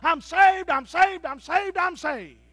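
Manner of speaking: high, loud praise cries, fading toward the end; joyful shouting. Retold or imitated. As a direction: shout hallelujah like a man overjoyed. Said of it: "went all the time".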